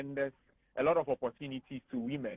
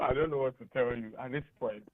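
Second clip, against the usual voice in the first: ordinary talk, nothing marked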